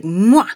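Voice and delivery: exaggerated "mwah" smooch; loud kiss noise. Drunk guy lunging at her for a kiss, imitated loud smooch. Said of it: "he tries to kiss me".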